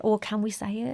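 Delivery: very soft, tentative question; timid asking. Retold or imitated. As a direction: imitate people unsure they're allowed. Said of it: "leaves other people a little bit like".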